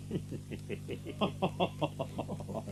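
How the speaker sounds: low, quiet mocking laugh; doctor and preacher laughing at the king; scornful laugh. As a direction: laugh the fraud off under your breath. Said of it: "and then started to laugh".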